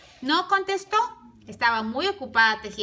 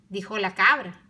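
ordinary delivery, nothing marked

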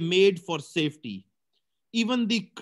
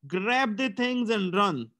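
ordinary delivery

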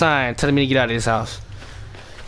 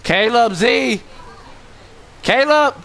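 calling out; 7 semitones higher, slower than usual. Real, not acted. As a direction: shout the name in alarm. Calling friend in panic.

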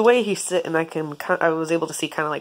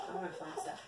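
soft whispered animal voice; whispered chicken voice; 19 dB quieter than usual. Pretend. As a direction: breathe it like the bird. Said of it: "He's like".

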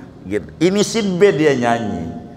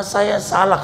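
ordinary delivery

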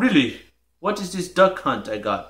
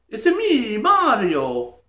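high-pitched game catchphrase voice; Mario; 3 semitones higher, slower than usual. Acted. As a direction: announce yourself like a cartoon plumber. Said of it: "I can talk".

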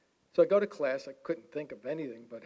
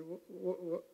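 mock stunned stuttering, slightly higher; stunned stammer; 4 semitones higher, 5 dB quieter, slower than usual. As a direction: stammer as if lost for words. Stunned reply to terrible news.

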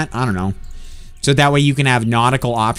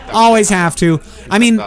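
ordinary delivery

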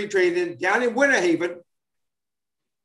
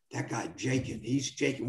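much softer, lower, imitating clubhouse gossip behind his back; hushed gossip; 8 semitones lower, 11 dB quieter than usual. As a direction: drop your voice like teammates whispering about a jinx. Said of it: "like, little people were saying".

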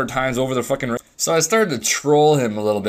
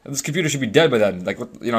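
ordinary talk, nothing marked

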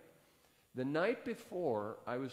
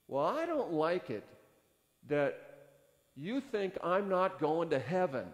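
agitated complaint; 5 semitones higher, 6 dB louder than usual. Retold or imitated. Angry young man complains.

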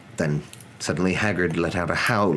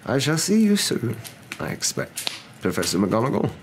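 Dumbledore; 7 semitones higher than usual. A narrator's reading. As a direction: bid a courteous goodbye, pitched up. Dumbledore taking his leave.

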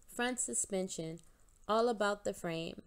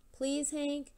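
begging; 6 semitones higher than usual. Acted. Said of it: "the mouse begged".

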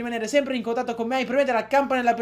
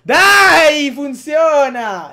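loud frustrated shout, much higher; explosive Italian cursing; 6 semitones higher, 12 dB louder, much slower than usual. Real, not acted. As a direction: yell at the computer. Can't stop the recording.

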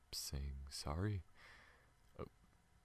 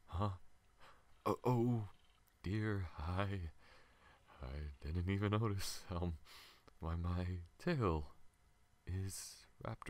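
flustered surprise; 4 semitones higher than usual. Acted. Alien noticing its tail around the human, flustered surprise. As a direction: catch yourself suddenly, embarrassed.

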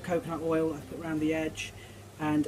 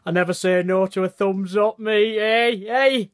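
playful cheer; 2 semitones higher, 6 dB louder than usual. Real, not acted. Playful loud thumbs-up plea.

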